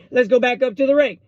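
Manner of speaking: bad Oklahoman accent, pitched high; Jim Ross. Commentator throwing back to the ring, high mock Oklahoman accent. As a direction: call it like a hammy Oklahoman wrestling commentator. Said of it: "That was the worst Oklahoman you'll ever hear".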